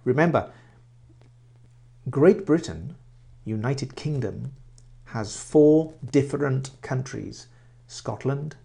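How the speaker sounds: usual speaking voice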